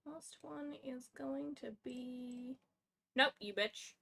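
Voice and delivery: ordinary delivery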